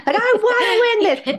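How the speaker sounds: pitched high, slow at first, laughing through it; mock fired-up contestant. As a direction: play a desperate cooking contestant for laughs. Contestant pleads to TV chef.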